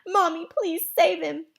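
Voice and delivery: put-on high, tearful little kid; whiny, weepy pleading; blubbering. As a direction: blurt it out through tears. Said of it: "Joey blubbered out".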